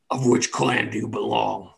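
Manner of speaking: lightly voiced orc questioner; a hint of a formal orc challenge. Orc asks Jarzak about his clan, lightly voiced. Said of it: "the orc says".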